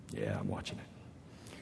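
very low, slow grudging mumble; acted reluctant admission, barely voiced; sulky reply. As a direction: answer under your breath while pouting. Pouting reply to God's voice.

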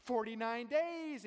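high, slow, gushing excitement; giddy countdown. Retold or imitated. Preacher imitating a giddy engaged person, squealing. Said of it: "it's like a giddy".

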